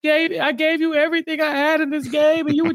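high-pitched imitation of a crying player; hammed-up wail of betrayal; mock tearful protest. Host acting out a betrayed player crying to an ally, mock tearful. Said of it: "Siwon is crying".

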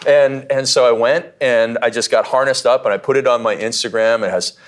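usual speaking voice

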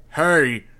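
sudden loud high shout; roar. A narrator's reading. The mayor roaring at the silent hero, furious shout. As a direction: bellow to break the silence. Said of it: "he roared".

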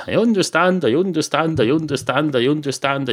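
high-pitched, insistent run of repeats; insistent repetition. Acted. Cat assuring the barman over and over, insistent.

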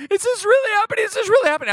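very high, frantic repeated question; panicked disbelief. Retold or imitated. People on the video panic, very high and frantic. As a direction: cry it out in panic. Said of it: "you hear people going".

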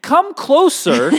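slow, high, loud yell. Retold or imitated. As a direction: call out over a partition, slowly. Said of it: "I'm gonna try to yell".

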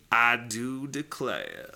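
slow hammed-up Southern drawl; I do declare, Southern style; Southern accent. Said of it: "Get your Southern accent ready".

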